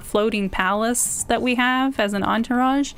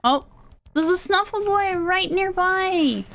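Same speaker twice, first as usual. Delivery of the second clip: high, drawn-out excited coo; 9 semitones higher than usual. Real, not acted. Player spotting a Snuffle Boy nearby, high slow excited voice. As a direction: stretch it out high with delight. Delighted at hearing a favourite creature.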